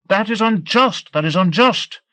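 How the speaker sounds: high-pitched outcry, not full volume; screamed protest. A narrator's reading. Captive protesting a long prison sentence, screaming. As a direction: cry out against it in a high, strained protest. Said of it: "I screamed".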